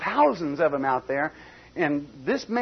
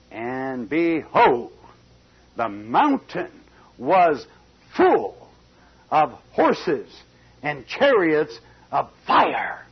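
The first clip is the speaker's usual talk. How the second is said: very slow, word by word, louder on full; dramatic reveal; 5 dB louder, much slower than usual. Acted. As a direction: build it up to the vision.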